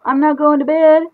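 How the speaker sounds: put-on shrieking little kid; defiant child. Child refuses.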